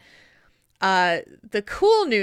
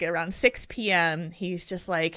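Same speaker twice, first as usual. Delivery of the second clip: normal talking voice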